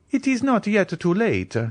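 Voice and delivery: high, eager steward; eager cry. A narrator's reading. Bertuccio eagerly urging the Count, high and pressing. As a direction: rush to change his master's mind. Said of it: "cried Bertuccio, uh, eagerly".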